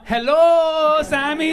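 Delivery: high, loud grandma greeting. Acted. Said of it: "Hey, Grandma".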